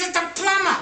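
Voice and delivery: high, hammed-up exasperated yell; over-excited caller. Plumber answering the parrot yet another time, over-excited yell. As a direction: yell it as if at your wits' end. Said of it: "He goes so excited".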